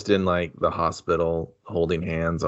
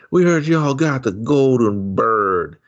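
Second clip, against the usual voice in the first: slow, higher mock gangster line; hammed-up thug demand; robber voice; 5 semitones higher, 4 dB louder, slower than usual. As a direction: ham up a tough robber. Robbers voiced in the made-up movie.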